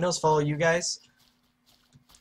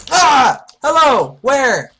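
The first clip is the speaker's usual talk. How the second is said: startled yelling; 9 semitones higher, 12 dB louder, much slower than usual. Real, not acted. Ambushed suddenly.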